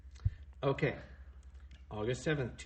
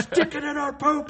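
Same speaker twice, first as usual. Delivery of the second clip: put-on much higher, louder kid voice; childish voice; 14 semitones higher, 7 dB louder than usual. Joining the poop joke, a high loud kid voice. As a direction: echo like a silly small child.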